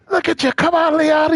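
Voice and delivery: high-pitched English corner coach urging his fighter; a coach in an English accent, pitched well up; high English accent, pushing a downcast fighter. Retold or imitated. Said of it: "they all got those English accents".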